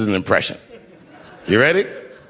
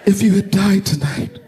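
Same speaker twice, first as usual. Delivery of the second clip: higher, hammed-up weeping wife; imitating his crying wife, voice raised and pleading; mock tearful wife; 7 semitones higher than usual. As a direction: play the wife crying over a near loss. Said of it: "And she's crying".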